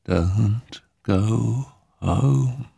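very slow, dying-away ghostly warning; fading spirit voice. Acted. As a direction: let the warning fade away, very slowly. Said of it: "The voice grew fainter".

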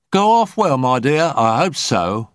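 high-pitched voice, settling as it goes; high, sociable woman medium; chatty medium. A narrator's reading. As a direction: start high and loud, then ease down. Medium coming round, chats.

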